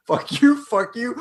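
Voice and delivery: high-pitched, indignant retort; mock defiance. Imagining his defiance before surrender, mock-angry. Acting out friend's earlier defiance.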